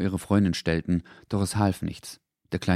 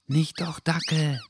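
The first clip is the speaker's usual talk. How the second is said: soft, higher voice with a touch of worry; quiet worried voice; 9 semitones higher, slower than usual. A narrator's reading. Someone in a doorway hushing the dog, softly worried. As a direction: hush the dog gently, a little worried. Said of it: "sprach eine leise Stimme plötzlich besorgt".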